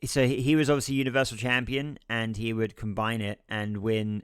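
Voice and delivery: ordinary talk, nothing marked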